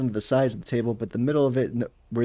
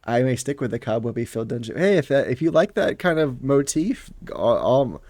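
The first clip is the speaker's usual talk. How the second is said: ordinary delivery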